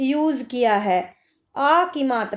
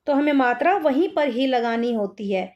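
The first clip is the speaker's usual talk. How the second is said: normal talking voice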